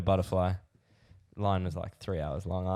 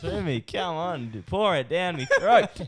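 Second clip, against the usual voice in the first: high, slow, laughing pour-it-down plea; laughing mock plea; 8 semitones higher, 4 dB louder, slower than usual. Urging his mate to pour the drink, high and laughing. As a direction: ham up the giddy begging. Drunk self voiced in story.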